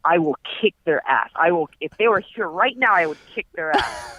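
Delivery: high, hammed-up threats; mock threatening rant. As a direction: threaten like a blustering landlord. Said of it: "he's like".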